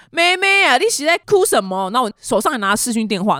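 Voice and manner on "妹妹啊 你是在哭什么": higher-pitched, louder imitation of a concerned uncle; neighbour uncle. Neighbour uncle asks the crying girl what's wrong, voiced high and concerned. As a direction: call over like an older neighbour, pitched up. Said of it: "隔壁有个阿伯...就说".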